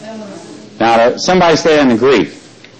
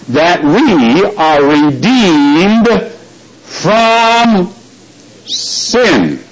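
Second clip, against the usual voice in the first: slow, heavy stress on each of "redeemed from sin"; weighty drawn-out pronouncement; much slower than usual. Retold or imitated. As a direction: stretch out "redeemed", "from" and "sin", pausing between. Mock-solemn quote of what references say.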